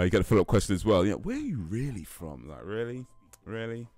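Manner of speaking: ordinary talk, nothing marked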